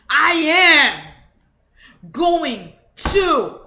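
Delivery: loud, punchy I then a slow going to; a strong start that trails into going to; forceful declaration. Shown as a demonstration. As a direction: announce it with full force. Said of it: "I had to stop, because that's a weak statement".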